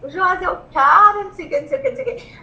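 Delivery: high, stretched gushing cry; re-enacted squeal of amazement; retold excited yelling. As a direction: gush in a high voice, then rattle off. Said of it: "Comecei a falar alto, a gritar".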